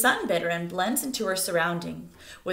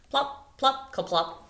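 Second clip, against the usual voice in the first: mock popping calls, plop plop; playful bird-call imitation; grouse popping sounds; 6 semitones higher, slower than usual. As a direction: pop it out like an air sac bursting.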